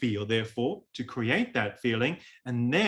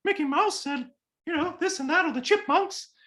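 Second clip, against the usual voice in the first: much higher cartoon mouse voice; Mickey Mouse falsetto. Shown as a demonstration. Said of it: "it's falsetto sound like".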